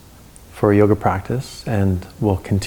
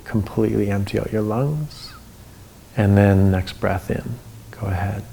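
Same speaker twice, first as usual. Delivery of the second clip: guided breathing. Acted.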